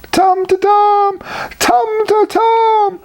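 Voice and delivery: very high, slow anguished wail; wailing in agony. A narrator's reading. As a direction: cry out in pain under the tree. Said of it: "he wailed in agony".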